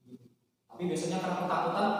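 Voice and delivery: normal talking voice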